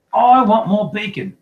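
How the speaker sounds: high, loud, drawn-out demand; slow, high whine at full volume; demanding child. A narrator's reading. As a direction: bellow for it like a spoiled boy. Greedy son demands food at breakfast.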